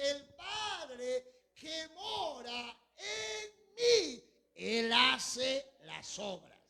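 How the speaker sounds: very slow, loud drawn-out proclamation; emphatic shout. Acted. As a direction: stretch each word and hit it hard. Preacher quotes Jesus at full force.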